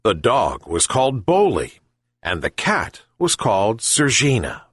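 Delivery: usual speaking voice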